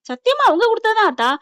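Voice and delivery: high, loud incredulous question; acted disbelief. Sister challenging brother over where the money came from, incredulous. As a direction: throw it back sharply, pitched right up.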